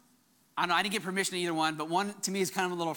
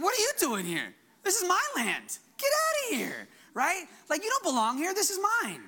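mock-indignant, much higher, rising to a near squeal on "Get outta here"; sassy chutzpah; 10 semitones higher than usual. Adam and Eve telling the serpent to get out, sassy and high. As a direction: shoo the serpent away like it's trespassing. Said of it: "There needs to be a little more sass".

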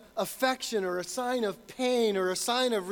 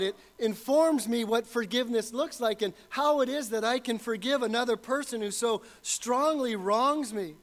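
normal talking voice

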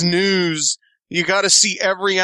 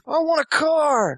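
high, strained whine; frantic demanding hostage taker; whiny hostage demand; 9 semitones higher than usual. Retold or imitated.

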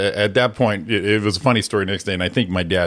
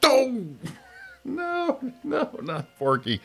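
mortified plea; 6 dB quieter, slower than usual. Retold or imitated. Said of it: "I'm like".

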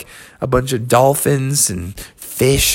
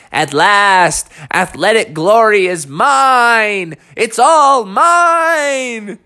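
grandiose cry climbing higher and slower; exultant sloth; 5 semitones higher, slower than usual. Acted. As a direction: build to a long, high gloat.

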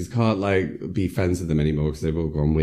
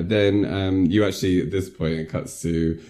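normal talking voice

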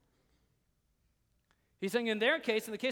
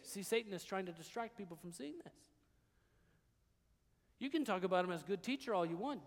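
normal talking voice

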